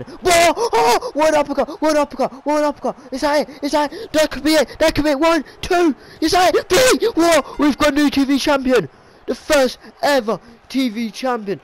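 shouted over the crowd, sky-high, crowning the new champion; screaming excitement. Acted. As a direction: lose it completely at the title win. Title win climax of commentary.

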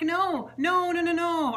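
high, loud no no no protest; flustered denial. Retold or imitated. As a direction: hurry to deny it, flustered. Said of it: "I'm like".

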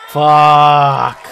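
loud, long frustrated groan of a curse. Real, not acted. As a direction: groan it out in envy. Streamer hears about E3 booth.

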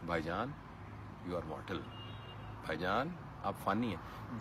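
low, hushed murmur, voiced; soft reminder in the ear. Imagined angel companions reminding a man he is mortal, soft reminder in the ear. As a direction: say it gently, as if into someone's ear. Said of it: "वो आपके कान में हल्का हल्का कहते रहें".

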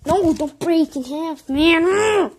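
loud, slow desperate plea; pleading shout. Real, not acted. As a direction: beg it loudly.

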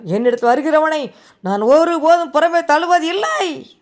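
high, loud scripture declaration; forceful proclamation. Real, not acted. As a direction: thunder the promise to the congregation. Preacher proclaims a scripture promise. (உரத்த பிரசங்க முழக்கம்)

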